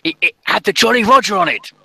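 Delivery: put-on high, loud old sailor, stumbling over words; elderly crewman. Old crew member swearing he saw pirates, high excited voice. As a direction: stammer it out as an insistent old man. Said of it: "um, the elderly, um, crew members".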